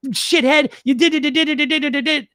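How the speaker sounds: nasty inner critic. Retold or imitated. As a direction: jeer at yourself for the mistake. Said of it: "This voice".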